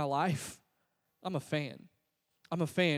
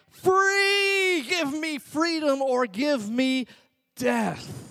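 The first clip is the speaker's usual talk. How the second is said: high, loud cry of defiance, first word drawn out; rousing cry; 7 semitones higher, 4 dB louder, much slower than usual. Acted. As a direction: cry out like a rebel rallying a crowd. Acts out everyone's rousing answer.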